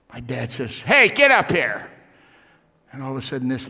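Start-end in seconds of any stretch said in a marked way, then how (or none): 0.8-1.9 loud slow call to come up front. Retold or imitated. Dad calling the cousin up front, loud slow call. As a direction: shout him up to the front.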